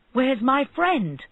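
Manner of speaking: slow, loud angry demand. A narrator's reading. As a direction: insist on an answer. Angry barber demanding friend.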